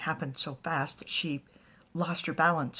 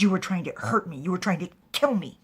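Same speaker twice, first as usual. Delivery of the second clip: angry accusation; 3 semitones higher, 9 dB louder than usual. Retold or imitated. She accuses him loudly.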